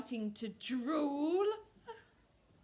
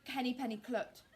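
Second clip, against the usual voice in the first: plain reading voice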